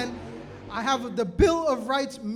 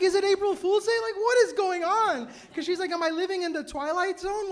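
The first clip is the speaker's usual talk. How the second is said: mock-incredulous, pitched up; bewildered disbelief; 6 semitones higher, 3 dB louder than usual. Teacher stares at a student's outrageous flyer, bewildered disbelief. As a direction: act out a teacher's higher-voiced bafflement.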